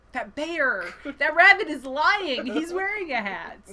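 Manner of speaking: high, loud, drawn-out mock outrage; imitated indignant kids. Middle schoolers catching the rabbit lying, mock indignant. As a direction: call out the liar like a scandalized kid.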